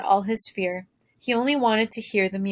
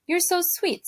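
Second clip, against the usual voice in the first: lightly voiced princess, a bit higher and softer; 8 semitones higher, 3 dB quieter than usual. A narrator's reading. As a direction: coo it like a fond princess. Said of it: "said the princess".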